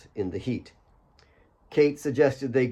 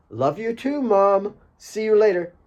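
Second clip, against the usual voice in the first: cheeky call; 9 semitones higher, 4 dB louder than usual. Acted. Alex calling while jogging off.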